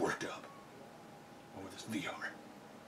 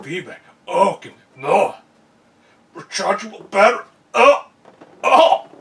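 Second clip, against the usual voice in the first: rising, louder mock passion; hammed-up mock ecstasy; 5 semitones higher, 15 dB louder, slower than usual. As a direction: ham it up like swooning over the features. Mock ecstasy over features.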